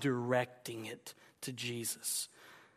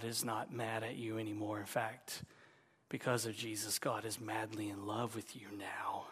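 much softer, dropping to a whisper; soft hush; 6 semitones lower, 10 dB quieter than usual. Real, not acted. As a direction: lean in and whisper the good news. Preacher softens to comfort.

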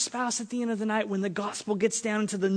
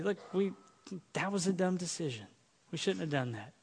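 subdued admission; 9 dB quieter than usual. Acted. Leaders acting out an admission.

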